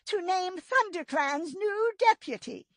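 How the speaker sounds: high, weary leader voice heavy with grief; tired sad voice. A narrator's reading. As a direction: announce the duty sorrowfully. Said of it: "Her voice was tired and cracked with sadness".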